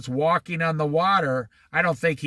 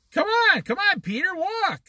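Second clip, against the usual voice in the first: high-pitched acted urging; urgent coaxing; 12 semitones higher than usual. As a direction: play at calling someone to come. Voices Jesus urging Peter on.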